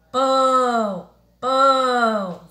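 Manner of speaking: "pão" held long and loud through the nose; nasal vowel. Shown as a demonstration. Teacher has pupils feel the nose, nasalised "pão". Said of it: "Você sente aqui no nariz".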